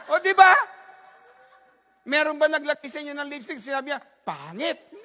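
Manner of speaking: loud, high, fervent preaching; preaching at full force. Acted. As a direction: rouse the congregation to share. Preaching to congregation. (masigasig na pangangaral nang malakas)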